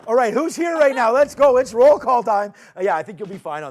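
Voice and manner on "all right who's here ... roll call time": loud, high hype-man call; hyped announcing. Real, not acted. Host launching the show's roll call, hyped announcing. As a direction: kick it off big and loud.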